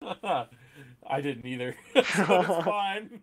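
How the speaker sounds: laughing. Real, not acted. Laughing at own bad roll.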